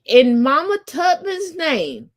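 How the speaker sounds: preacher invocation. Pretend. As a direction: call on Mama Tubman like a preacher at the pulpit. Invoking Mama Tubman like preacher.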